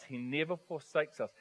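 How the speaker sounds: ordinary talk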